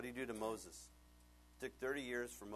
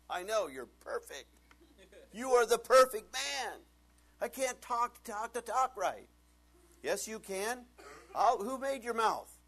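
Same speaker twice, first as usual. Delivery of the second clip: high, loud, slow playful God voice; God coaxing a reluctant Moses; God coaxing a reluctant Moses, playfully; voicing God; 12 semitones higher, 15 dB louder, slower than usual.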